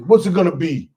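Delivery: big, punchy barkeep voice; tough Philly bartender. Retold or imitated. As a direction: bark an order-taking question like a gruff barman. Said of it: "he looks like Rocky".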